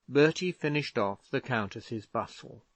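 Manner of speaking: ordinary delivery, nothing marked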